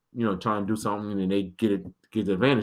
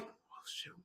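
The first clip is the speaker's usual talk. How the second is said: panicked whisper; hushed nervous whisper; 27 dB quieter than usual. Retold or imitated. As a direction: whisper it under your breath.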